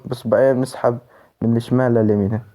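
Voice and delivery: usual speaking voice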